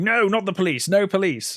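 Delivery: mock plea, voice lifted; retold alarmed refusal. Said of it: "she's like".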